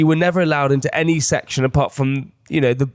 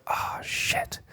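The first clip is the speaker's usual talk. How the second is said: whispered curse; 13 dB quieter than usual. Retold or imitated.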